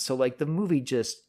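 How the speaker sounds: normal talking voice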